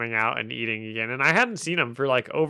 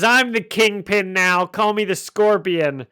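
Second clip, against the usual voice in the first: higher, louder villain proclamation; Scorpion's scary monologue; 6 semitones higher, 5 dB louder than usual. Acted. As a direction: declare yourself the new crime boss, menacingly. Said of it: "did the whole scary monologue pretty well".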